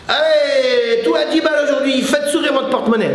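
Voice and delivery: high-pitched street hawker's call; market vendor. Acted. Coach clowning as a bargain seller, market vendor's patter. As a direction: hawk bargains in a singsong sales cry. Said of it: "tu m'as pris pour un vendeur".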